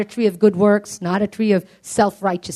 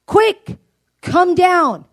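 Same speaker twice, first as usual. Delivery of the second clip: calling out; 7 semitones higher, 7 dB louder, much slower than usual. Acted. Jesus calls Zacchaeus from tree.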